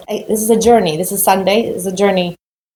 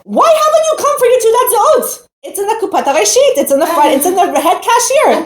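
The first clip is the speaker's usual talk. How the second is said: imitating a cashier calling out, much higher pitched; mock yell; 10 semitones higher than usual. Cashier calling out to her from the bus stop, high mock yell. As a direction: call it across the street at her, pitched way up. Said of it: "one of the cashiers yells at me".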